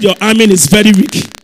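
shouted challenge to the congregation; booming preacher's prod; loud rebuke. Real, not acted. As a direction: call out loudly for a stronger response. Scolds congregation for weak response.

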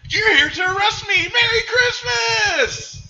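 very high, loud, slow, stretched-out cry; ecstatic shout. Retold or imitated. George to the police at his house, a very high, loud, stretched-out shout. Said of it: "He's like".

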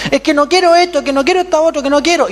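put-on high, loud whining kid; whiny child voice. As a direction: whine like a spoiled child refusing. (voz de niño caprichoso, aguda y quejumbrosa)

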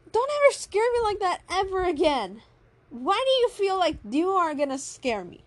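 high, worked-up acted complaint; indignant scolding. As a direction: scold the one who scared you. Acted scolding after a scare.

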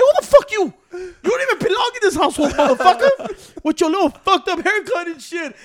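high, loud laughing tirade; mock outrage. Telling off the nephew's friend.

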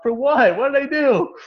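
high, loud indignant protest; imitated outraged shout; retold scream. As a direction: replay yelling at the umpire in disbelief. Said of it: "I remember I screamed at him".

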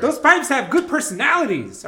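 high, loud mock defense; playful shouting. Friend defending the skinny pipes to the host, playful shouting.

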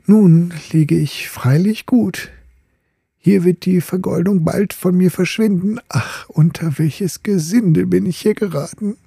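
high, lamenting voice of a fallen top; dismayed gilded top. Acted. The gilded top grumbling in the rubbish bin, high and dismayed. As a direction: sigh over his bad luck, high and hurt.

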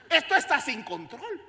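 laughing at the chaos; loud laughing exclaim. Real, not acted. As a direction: shout it through laughter. Preacher laughing loudly.

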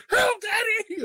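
put-on high frightened kid cry; scared child voice. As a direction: squeal it like a startled little boy. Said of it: "my son wakes up and he's like".

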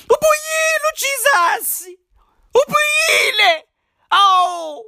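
high, shouting church rapture; crying out that Jesus is back; ecstatic worshipper. Acted. Worshipper at Easter shouting high with joy that Jesus returned.

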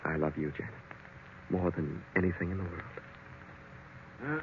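soft, slow, intimate vow; tender declaration. Acted. Husband vowing love to Janet, tender and soft. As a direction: hush it like a secret promise.

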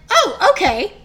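put-on high, light child voice; kids accepting it. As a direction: chirp it like a satisfied kid. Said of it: "and then they went".

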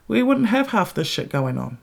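ordinary talk

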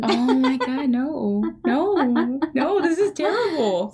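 high, rising cries of no; horrified. Real, not acted. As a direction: beg it not to be true. Horrified by escaped snake.

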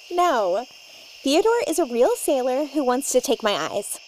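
ordinary delivery

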